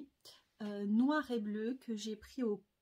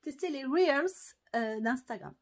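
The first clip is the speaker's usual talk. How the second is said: English accent; 3 semitones higher, 6 dB louder than usual. Shown as a demonstration. Vlogger repeating "Reels" after joking about it, English accent. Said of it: "j'ai un super accent anglais".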